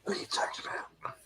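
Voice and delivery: soft whispered question into cupped hands; hushed whisper. Pretend. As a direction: whisper it, trying to be quiet. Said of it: "I'm trying to be quiet".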